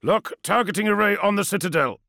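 loud, high order; curt command. Acted. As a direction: give it curtly. Kratos orders.

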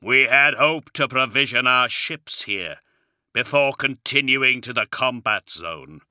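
booming, heavy warrior voice. Acted. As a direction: speak like a towering giant. Primarch voice continues.

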